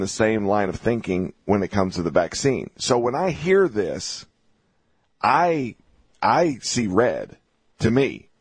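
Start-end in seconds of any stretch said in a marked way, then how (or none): none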